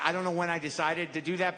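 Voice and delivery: usual speaking voice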